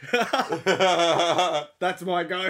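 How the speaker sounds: words through a big laugh. Real, not acted. Laughing line after a joke.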